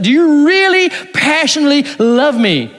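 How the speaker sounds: Jesus pressing Peter with a stronger word for love; emphatic plea. Retold or imitated. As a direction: press the question with real emphasis. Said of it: "it's like a real emphasis".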